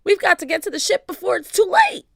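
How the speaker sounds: high, loud and fast; urgent shout. A narrator's reading. Pinky urging a retreat to the ship, shouting. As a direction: rush it out in alarm. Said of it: "shouted Pinky".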